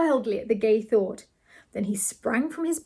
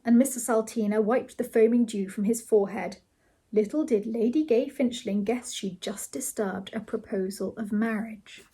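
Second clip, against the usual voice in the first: usual speaking voice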